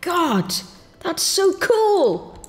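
high, slow drawn-out delight; amazed. Real, not acted. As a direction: savour the wow. Delighted by build result.

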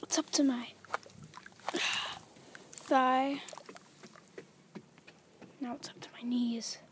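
hushed, breathless whisper. Real, not acted. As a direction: whisper it as you sink. Whispering while sinking.

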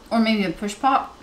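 chewing while talking; talking between bites; mouth full. Real, not acted. Seen 1: Woman puts snack piece to mouth, talks while eating it.

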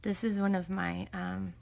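ordinary delivery

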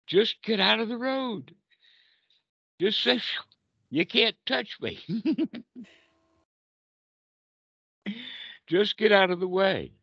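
high, light and breezy, laughing at the danger; playful relief. Acted. Teacher playing the meditator dodging the truck, high and gleeful. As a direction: dodge it with a gleeful grin.

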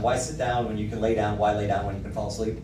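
ordinary talk

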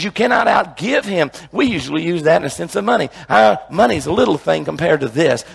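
loud, driving sermon delivery; preaching at full force. Acted. Pastor urging the congregation to receive, loud and driving. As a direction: pound it home from the pulpit.

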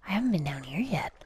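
hushed, fast whisper; creeping whisper in a cave. Real, not acted. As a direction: whisper as if sneaking in. Exploring a new cave.

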